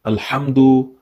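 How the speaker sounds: "Alhamdu" with a strong, throaty ḥ. Shown as a demonstration. Teacher stresses the ḥ for students, throaty. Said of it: "is with a ha".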